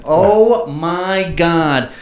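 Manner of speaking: loud, high, drawn-out gush; real excited exclamation. Real, not acted. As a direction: stretch each word out, loud and thrilled. Hyping up his best story.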